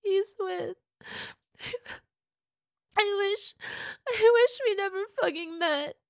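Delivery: high, loud sobbing wail. Acted. Partner sobbing that only her lover brings her ease, wailing. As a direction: wail it through tears.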